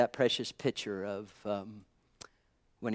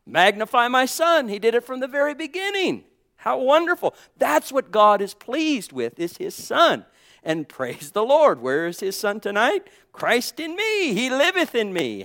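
high, loud, ringing preacher's cry; full-force preaching; 8 semitones higher, 6 dB louder than usual. Acted. Pastor exhorting the congregation, loud full-force preaching. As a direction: preach it out to the whole church.

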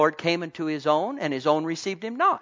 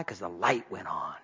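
whispered, much lower and softer; hushed aside; 11 semitones lower, 5 dB quieter than usual. Acted. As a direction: drop to a secret whisper.

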